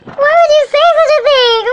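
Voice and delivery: high, loud, dramatic mock gasp of offence; pretending to be shocked at a comment, hammed-up. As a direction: ham up being scandalised.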